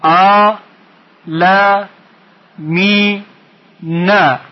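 "ع، ل، می، نه" as four isolated beats; syllable by syllable. Shown as a demonstration. Teacher splitting the word for pupils, syllable by syllable. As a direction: pause after each syllable, hold "می" long. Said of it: "یک بار دیگه".

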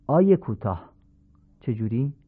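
usual speaking voice

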